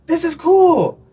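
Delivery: high-pitched imitation of admiring children; mock child's enthusiasm, loud; excited kids. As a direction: pitch it up like a kid cheering. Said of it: "the kids would come to me".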